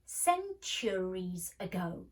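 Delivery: "centuries" said slowly, every syllable spelled out; careful pronunciation. A narrator's reading. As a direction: lay out "centuries ago" slowly and precisely. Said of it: "pronouncing the word carefully".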